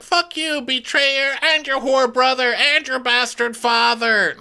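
very high hammed-up tirade; furious cursing. Captive cursing the crab feeder and his family, mock fury. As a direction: hurl each insult in a high mock yell.

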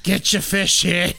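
slow, stretched hawker's cry; mock vendor's call. Stadium seller hawking fish, mock vendor's call. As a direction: lightly call out wares to a crowd.

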